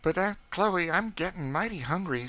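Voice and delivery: put-on young boy voice. A narrator's reading. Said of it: "said George".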